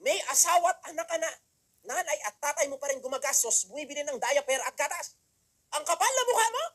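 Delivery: loud, high mock scolding; scolding speaker. Speaker shaming a grown man who still lives off parents, loud. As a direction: imitate the speaker's shaming rant. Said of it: "ganun yung magsalita eh".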